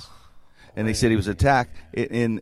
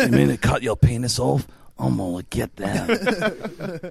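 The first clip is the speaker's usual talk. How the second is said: slowed, drawn-out action-hero voice; mock Jason Statham; slower than usual. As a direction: play the movie hard man offering help, hammed up. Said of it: "Statham would help the guy".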